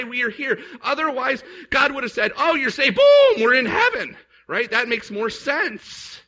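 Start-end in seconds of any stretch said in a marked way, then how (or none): 2.4-4.2 higher and louder, bursting into a triumphant Boom; a gleeful shout of instant rescue; mock excited. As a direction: sell it like an instant happy ending. God voiced as a quick fix.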